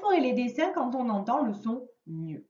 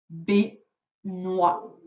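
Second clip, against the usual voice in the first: "Baignoire" broken into separate syllables; "Baignoire" spaced out for counting syllables; split by syllables; 4 semitones lower than usual. Shown as a demonstration. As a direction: sound out "Baignoire" piece by piece, with gaps. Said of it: "Deuxième syllabe".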